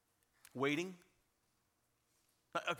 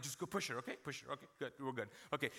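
dismissive dad; 5 semitones lower, 5 dB quieter, much faster than usual. Acted. Distracted dad dismissing son while watching TV.

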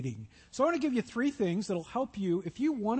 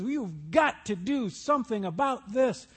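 mock angry demand; 3 semitones higher, 4 dB louder than usual. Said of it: "she is just angry and agitated and irritated".

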